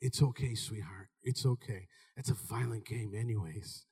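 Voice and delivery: low, soft soothing mom voice; coddling mother. Retold or imitated. Mother soothing her son after he quits, soft and low. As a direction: comfort him gently. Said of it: "I'm like".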